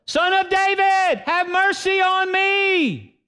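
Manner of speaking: very high, slow, drawn-out plea; crying out. Acted. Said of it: "he cried out all the more".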